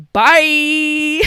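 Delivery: loud, drawn-out bye; breezy loud goodbye. Acted. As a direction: sing out a big farewell. Acted departing mom's farewell.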